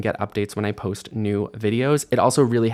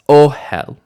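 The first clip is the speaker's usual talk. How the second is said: ohel with a high, loud first syllable; initial stress; 4 semitones higher, 8 dB louder than usual. Shown as a demonstration. Teacher summing up the features, initial stress on ohel. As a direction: punch the O of ohel, let HEL drop. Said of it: "and initial stress".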